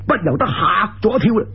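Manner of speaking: ordinary delivery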